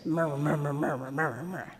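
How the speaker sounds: low, soft mumbled repetition; murmuring. Shown as a demonstration. Preacher showing the congregation the word's sound, murmuring. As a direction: murmur it under your breath like background grumbling. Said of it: "it sounds like what it is".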